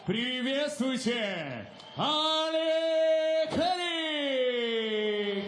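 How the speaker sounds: very high, very slow shouted name; boxing ring announcer. Acted. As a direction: hold the name long for the arena. Announcer calls out fighter name.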